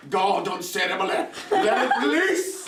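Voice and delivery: high, stretched-out revival holler; over-the-top praying, climbing high; mock altar prayer. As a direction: cry out in hammed-up deliverance prayer. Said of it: "pray for you like that".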